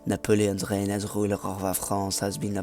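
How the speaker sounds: put-on French accent, narrating. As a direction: read it out in a mock French accent. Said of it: "I'm gonna stop doing my French accent now".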